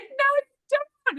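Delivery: very high mock bride brushing him off; hammed-up avoidant bride; dismissive bride. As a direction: squeak out a flat refusal. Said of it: "And she's like".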